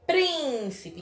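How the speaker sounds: "Príncipe" with long PRIN and quick light ending; stress on first syllable. Shown as a demonstration. Teacher repeats for pupils, "Príncipe" stressed on PRIN. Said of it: "prim é a antepenúltima sílaba mais forte".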